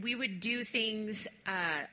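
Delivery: usual speaking voice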